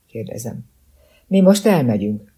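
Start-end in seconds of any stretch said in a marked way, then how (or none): 1.3-2.3 firm, louder and higher. Acted. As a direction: declare it with no room for argument. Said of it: "mondja Wells határozott hangon". (határozottan, hangosabban)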